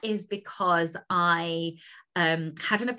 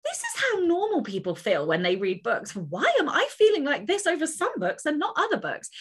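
puzzled wonder; 10 semitones higher than usual. Retold or imitated. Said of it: "And then I was like".

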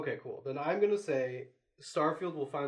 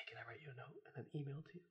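hushed playful whisper; 17 dB quieter, faster than usual. Pretend. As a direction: lean in and whisper. Whispered joke aside.

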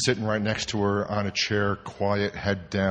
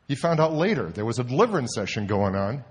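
ordinary talk, nothing marked

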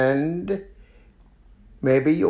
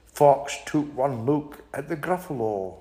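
usual speaking voice

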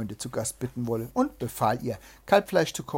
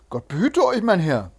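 loud, high hail; hailing greeting; 5 semitones higher, 8 dB louder than usual. A narrator's reading. Thief greets the approaching rider, hailing loudly. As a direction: sing out a greeting to a stranger.

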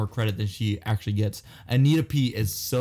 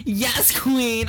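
high, sassy, hammed-up yes queen; 10 semitones higher than usual. Two friends joking, he hams up a camp voice. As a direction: camp it up, pitched way up.

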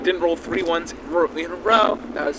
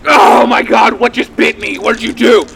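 loud, frantic shouting; panicked yell; 3 semitones higher, 8 dB louder than usual. Acted. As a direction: yell in panic at the unseen biter. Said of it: "He's like".

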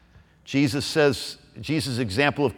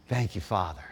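soft prayer; 7 semitones lower, 11 dB quieter than usual. Shown as a demonstration. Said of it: "Interrupt it with".

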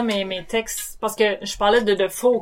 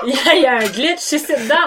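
laughing; 7 semitones higher, 11 dB louder, faster than usual. Real, not acted. Laughing at the glitch.

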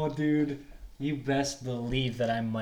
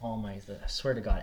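ordinary talk, nothing marked

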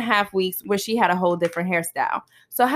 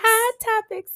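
high-pitched echoed title call; segment announcement; 13 semitones higher, slower than usual. Pretend. Co-host joins the hot topics intro late, high chiming call. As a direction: chime in with the segment name.

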